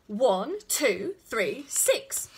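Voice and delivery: put-on toddler shouting out numbers crossly; angry shout. A narrator's reading. Connie drumming on her mother's knee, angry shout. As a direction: punch each number loudly, insisting. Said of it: "she shouted".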